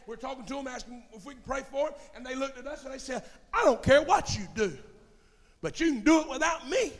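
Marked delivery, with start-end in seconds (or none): 3.5-6.9 higher, louder imitation of a brush-off; dismissive refusal. Resident turns away church callers offering prayer, loudly dismissive.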